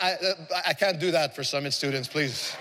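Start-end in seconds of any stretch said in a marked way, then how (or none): none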